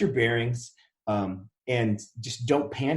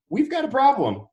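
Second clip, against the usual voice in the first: much higher and louder, a jolt of alarm; panicked alarm; 13 semitones higher, 4 dB louder than usual. Retold or imitated. Telling his business partner, a high panicked alarm. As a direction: blurt it out in sudden panic. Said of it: "I was like".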